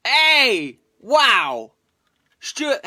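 loud, high cheer; excited greeting. Retold or imitated. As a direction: shout hello with delight. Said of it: "Michael Ash says".

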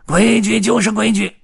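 high, loud quarrelling shout; roaring. A narrator's reading. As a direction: bellow it as final. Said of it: "灰胡子也咆哮道".

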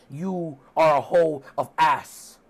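slow, theatrical insult; Matt Hardy. Retold or imitated. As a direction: pronounce each word with mock grandeur. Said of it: "Matt Hardy is like".

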